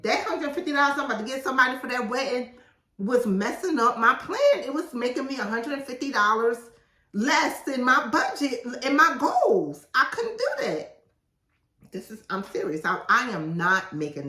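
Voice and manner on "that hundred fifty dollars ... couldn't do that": higher, louder, indignant rant; worked-up rant. Real, not acted. Author getting worked up about wedding gift money, higher and louder. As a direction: get riled up about wasted money.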